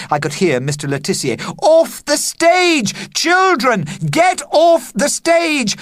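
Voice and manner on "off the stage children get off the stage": shouting. Acted. Teacher shouting at children.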